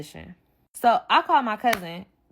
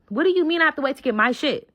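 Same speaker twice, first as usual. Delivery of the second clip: higher, quicker indignant demand; retold going off; 6 semitones higher, 4 dB louder, faster than usual. Told to wait for her belongings, heated indignation. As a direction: repeat it faster and more heated. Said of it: "I'm in the car going off".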